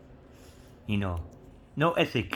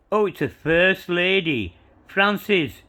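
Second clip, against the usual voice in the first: excited; 7 semitones higher, 7 dB louder than usual. Real, not acted. Excited discovery of a coin.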